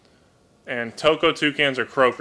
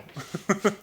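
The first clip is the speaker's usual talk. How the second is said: real, high, rising chuckle; short laugh climbing in pitch; 9 semitones higher, 4 dB quieter than usual. Real, not acted. Pleased by a viewer's sanctuary idea, chuckling.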